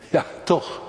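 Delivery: ordinary talk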